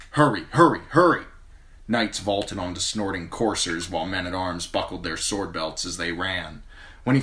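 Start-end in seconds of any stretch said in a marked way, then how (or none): 0.1-1.2 loud urgent calling out. A narrator's reading. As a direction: sound the alarm. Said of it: "The trumpets blew again".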